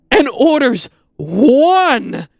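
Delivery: exasperated wail, dragged out; drawn-out disbelieving shout. Retold or imitated. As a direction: stretch every word as the absurdity builds. Climax of the ridiculous ad.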